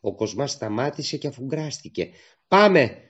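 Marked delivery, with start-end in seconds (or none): 2.5-3.0 loud, high call to go; a girl shouting to leave now; shouted call. Acted. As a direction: call out urgently to her partner. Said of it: "φώναξε η Παναγιώτα".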